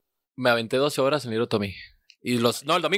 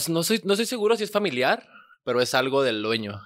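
normal talking voice

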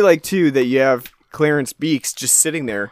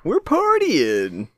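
high, very drawn-out whoop; mock party animal; 6 semitones higher, much slower than usual. Co-host joining the gorilla bit, drawn-out mock partying. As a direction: stretch it out like a goofy partier.

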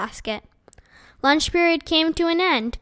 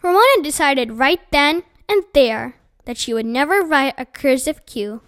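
usual speaking voice

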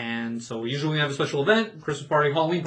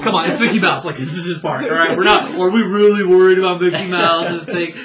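louder, playful mock protest; 4 semitones higher, 10 dB louder than usual. Cast member teasing guests, louder mock protest. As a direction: make the guests laugh with a louder fake complaint. Retold joke to guests, loud.

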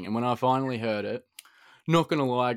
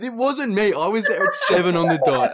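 laughing protest; 8 semitones higher, 7 dB louder than usual. Real, not acted. Laughing self defence.

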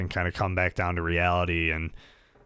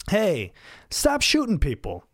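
pleading call out; 10 semitones higher, 3 dB louder than usual. Real, not acted. Calling out to the world.

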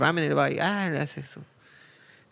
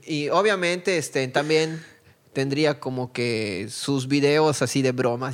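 ordinary delivery

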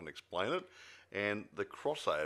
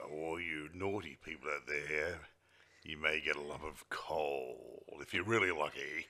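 spooky warning voice; 4 semitones lower than usual. Pretend. Mock Christmas warning.

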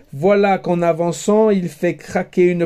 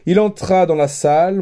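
plain reading voice

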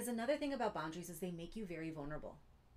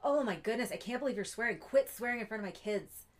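louder, higher exasperated snap; frustrated scolding; 4 semitones higher, 7 dB louder than usual. Shown as a demonstration. Mom snapping at a friend for swearing in front of kids, frustrated and loud. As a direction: scold in frustration, not from love. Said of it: "You're not gonna say it like".

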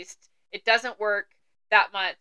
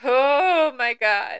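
floored by the line; drawn-out stunned exclamation; shocked oh my God; 3 semitones higher, 6 dB louder, much slower than usual. Real, not acted.

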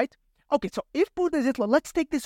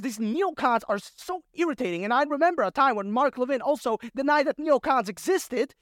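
usual speaking voice

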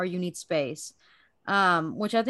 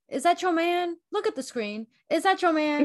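talk show host; 7 semitones higher than usual. Retold or imitated. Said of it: "Where he's like".

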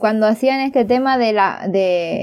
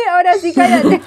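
playful teasing; 6 semitones higher than usual. Real, not acted. Teasing a friend to shut up.

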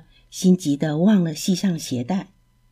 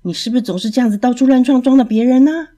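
fast, louder and higher huffy scolding; 5 semitones higher, 5 dB louder, faster than usual. A narrator's reading. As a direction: huff and scold the snail. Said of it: "气哼哼地对瓜牛说". (气哼哼地快速大声责问)

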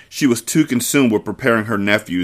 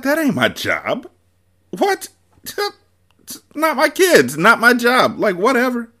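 dismissive woman. Acted. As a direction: brush them off, not my problem. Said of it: "The woman's like".

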